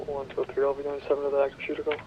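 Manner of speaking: ordinary talk, nothing marked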